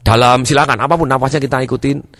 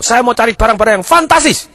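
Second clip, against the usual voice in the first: high, loud, enthusiastic customer; exaggerated high-pitched enthusiasm; hammed-up excitement; 10 semitones higher, 5 dB louder than usual. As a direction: build to a big punch on fantastis.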